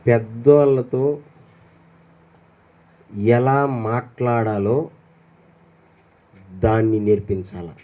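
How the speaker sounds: slow, low, deliberate words; a low, slow sermon point; slow emphatic point. Acted. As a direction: spell out the lesson slowly.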